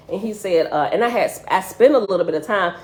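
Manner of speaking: normal talking voice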